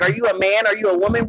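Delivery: usual speaking voice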